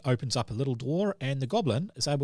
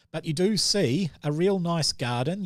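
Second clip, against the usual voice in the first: ordinary talk, nothing marked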